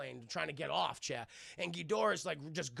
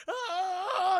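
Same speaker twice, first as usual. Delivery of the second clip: high loud cry of being flung; mock yelp; 13 semitones higher, 8 dB louder than usual. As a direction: yelp as you get tossed. Voicing a flung character.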